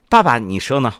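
ordinary talk, nothing marked

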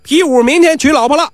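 high, carrying proclamation; public announcement. Acted. Nisi telling the whole gambling den, public announcement. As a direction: announce it to everyone present. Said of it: "大家都听着".

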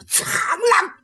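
high battle cry. Acted. Fight move called out.

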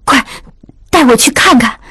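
put-on mildly urgent young lady; hurried, urgent. A narrator's reading. Lady urging her maid to take her to the rescuer, hurried. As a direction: press the maid to hurry. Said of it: "玉汉急忙说".

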